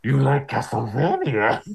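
slow, eerie psychic villain; drawn-out mind-reader taunt; Psycho Mantis voice. Pretend. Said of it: "Psycho Mantis impression".

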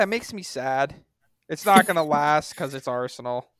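ordinary talk

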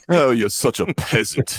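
put-on snooty English accent, slow; posh English accent mocking a peasant. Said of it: "English accent".

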